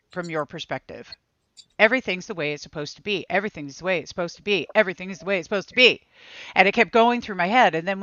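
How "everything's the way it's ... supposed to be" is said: fast, looping inner mantra; quick repeated chant, half to yourself. Retold or imitated. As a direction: rattle it off like a phrase stuck in your head. Repeating the mantra heard in meditation.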